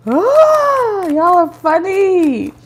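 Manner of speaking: high, drawn-out "ah" of amusement; long sing-song amused cry; amused squeal. Real, not acted. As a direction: coo it high and slow.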